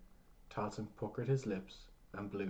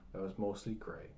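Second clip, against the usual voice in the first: plain reading voice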